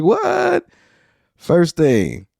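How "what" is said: stunned what. Retold or imitated. Everybody's reaction to the news.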